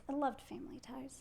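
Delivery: soft breathy whisper of fondness; fond whisper. Real, not acted. Wife whispering her love for Family Ties, hushed. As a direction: whisper it like a guilty pleasure. Wife gushing about old sitcom.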